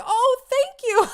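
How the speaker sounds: high, loud, drawn-out squeal; overwhelmed gratitude. Retold or imitated. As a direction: gush thanks, overcome with joy. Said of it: "so overwhelmed".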